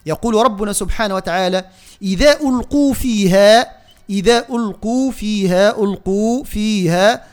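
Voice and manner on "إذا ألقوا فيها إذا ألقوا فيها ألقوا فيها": slow recitation of إذا ألقوا فيها with equal long vowels; measured, drawn-out chant on ألقوا فيها; balanced elongations. Shown as a demonstration. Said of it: "توازن أيضًا في المدود".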